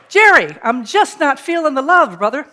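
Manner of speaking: calling Jerry out, half-joking; Rod complaining loudly. Retold or imitated. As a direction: belt out the complaint to his agent. Rod complains to his agent.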